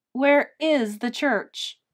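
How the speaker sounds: asked loudly. A narrator's reading. Girl to her dreamy mom, loud slow question. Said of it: "I asked loudly".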